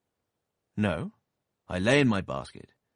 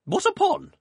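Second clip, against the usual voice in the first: loud, high, snapped question; sharp; 9 dB louder than usual. A narrator's reading. Aunt snapping sharply at the doctor. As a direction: snap it back at him.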